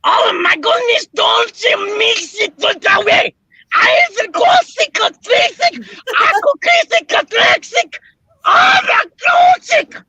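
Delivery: mock German accent yelling classic; Schultz German accent. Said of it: "you sound like Schultz from".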